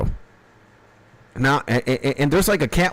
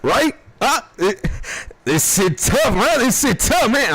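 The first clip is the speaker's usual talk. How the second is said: high, strained voice of a coughing stoned friend; coughing stoned homie; 9 semitones higher than usual. Retold or imitated. Friend squeaks about the strong weed between coughs, high strained voice. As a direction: squeak it out like you can barely breathe from the smoke. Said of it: "My homie was looking at me like".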